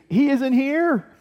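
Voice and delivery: high, loud proclamation of good news; excited announcement. Acted. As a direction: call it out high and joyful, acting the angel.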